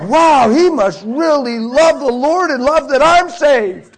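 loud, high, drawn-out wailing wonder; tearful mock amazement. New convert marvelling at his weeping friend, hammed-up tearful wail. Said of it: "he started crying".

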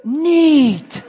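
put-on awed kid, laughing through it; like a little child. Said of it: "like a little child".

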